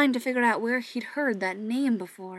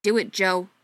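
louder curt command; snapped order; 7 dB louder than usual. Acted. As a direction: snap the order at him. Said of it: "Pete snapped".

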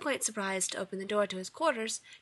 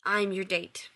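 a hint of grim resignation; mildly grim admission; slower than usual. A narrator's reading. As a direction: get it over with, understated. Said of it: "concluded grimly".

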